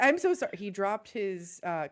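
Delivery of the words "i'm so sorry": high, loud flustered apology; flustered guard. Acted. The clerk Chucklehead panics and apologises, flustered and loud. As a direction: blurt out the sorry in a panic. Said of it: "He's like".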